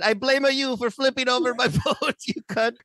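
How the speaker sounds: high, hammed-up Italian accent; joking Italian last words, half laughing; mock dying Italian accent. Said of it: "right before he died, he said".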